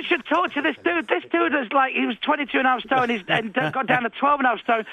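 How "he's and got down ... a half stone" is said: usual speaking voice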